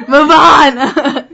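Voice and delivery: loud yell through laughter; exasperated shout. Real, not acted. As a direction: shout to hurry them along, laughing. Impatient prompting during a giggle fit.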